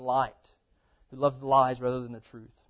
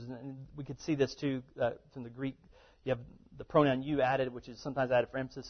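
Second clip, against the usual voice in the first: ordinary delivery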